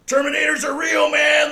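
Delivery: high, loud, frantic mock rant; ranting yell. Customer at the pawn shop yelling about Terminators, high and loud. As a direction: shout it like a conspiracy nut.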